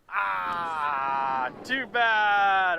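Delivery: loud, high groan of dismay; disappointed shout. Real, not acted. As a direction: cry out as the fish gets away. Fish lost.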